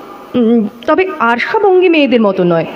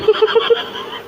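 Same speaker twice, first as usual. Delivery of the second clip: a short, soft, higher laugh; 7 semitones higher, 5 dB quieter than usual. Real, not acted. Hearing about the maid using his soap, soft amused laugh. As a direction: let a quiet laugh escape. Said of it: "হাসছো?".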